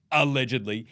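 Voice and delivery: ordinary talk, nothing marked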